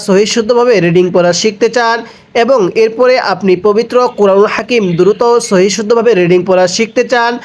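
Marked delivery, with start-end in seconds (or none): none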